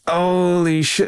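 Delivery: high, slow dawning horror; imitated aghast reaction; retold stunned realization. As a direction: stretch it out high as the blunder sinks in. Retold moment of realizing his blunder.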